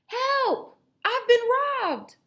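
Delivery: high, loud cry for help; hammed-up grandpa yelling for help; Grandpa shouting. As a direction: shout like a frantic old grandpa. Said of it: "we heard Grandpa shouting".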